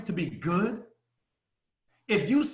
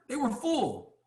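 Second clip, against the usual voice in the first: ordinary talk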